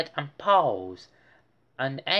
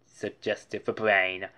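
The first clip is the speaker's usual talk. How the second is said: ordinary delivery, nothing marked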